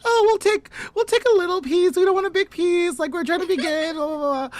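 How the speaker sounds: hammed-up dainty politeness; mock polite refusal. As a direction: play the prim guest declining dessert. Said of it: "we were just like".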